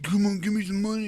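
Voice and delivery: high-pitched mock pleading; begging. Showing how the old man didn't ask, mock begging. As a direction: plead for cash like a pestering beggar. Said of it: "He wasn't, like, begging".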